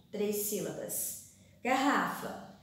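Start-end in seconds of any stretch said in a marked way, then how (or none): none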